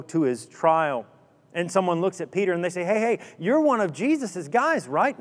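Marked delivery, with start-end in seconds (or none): 2.8-5.2 accusing bystander. Acted.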